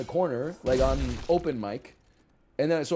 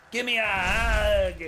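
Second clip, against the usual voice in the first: creepy monster voice; 7 semitones higher, slower than usual. Pretend. Scaring his nieces on Christmas Eve.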